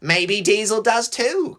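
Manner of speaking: high, slow mocking cry; singled-out, jeering high tease; radiating mockery. A narrator's reading. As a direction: taunt it high and drawn out. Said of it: "his voice radiating mockery".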